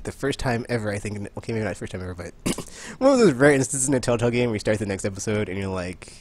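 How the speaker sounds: ordinary delivery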